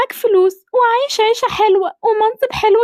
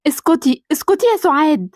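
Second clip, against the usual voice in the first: much lower, gentle motherly voice; lower-pitched mother greeting her son; older mother voice; 6 semitones lower than usual. Acted. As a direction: speak as the doting mother.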